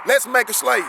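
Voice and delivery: ordinary talk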